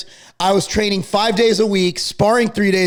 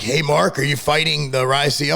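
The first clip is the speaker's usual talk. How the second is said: much lower, mock-dopey fan; imitated DM; 7 semitones lower than usual. Imitating a fan's DM, voiced low and dopey. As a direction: drop low to play the DM sender. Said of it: "I'm getting all these D Ms".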